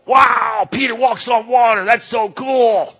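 high, gushing put-on wonder; mock amazement. Preacher parodying a wowed reader of the story, mock amazement. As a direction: gush it high, stretching the last words. Mocking naive reaction to the story.

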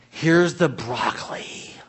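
slow whisper handing over broccoli; whispered offer. Retold or imitated. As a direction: hush it out slowly, like a secret. Parent offering broccoli quietly.

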